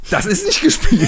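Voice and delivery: laughing high, insisting it's real; laughing protest. Real, not acted. Protests laughing, not acting. (lachend protestieren)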